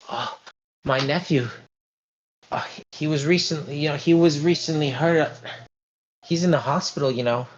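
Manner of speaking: a hint of a worried matron; lightly voiced fretful older lady. GM holds Mrs Lombardi figure.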